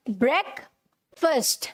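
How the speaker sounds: ब्रेकफास्ट slowly as "brek... fust"; correct pronunciation. Shown as a demonstration. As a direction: split ब्रेकफास्ट into two short-vowel syllables. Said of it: "जैसे ये लिखा है ना".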